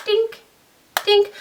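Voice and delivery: tiny high-pitched "dink"; imitated magnet clink. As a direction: mimic a little magnetic click, high. Imitating magnetic closure snapping shut.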